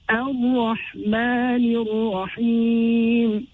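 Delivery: Ar-Rahmanir Rahim chanted slowly, straight into doubled r; silent lam. Shown as a demonstration. Teacher reciting the verse, Ar-Rahmanir Rahim slow, lam silent. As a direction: stretch Ar-Rahmanir Rahim, dropping the l. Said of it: "the lam falls away".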